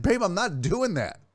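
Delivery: wife insisting she's not doing it, weary; mock wife denial. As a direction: imitate her denial. Acted quarrel with Nora.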